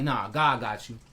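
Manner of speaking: slow, low and steady, like a calm promise; calm reassurance. Retold or imitated. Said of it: "I like".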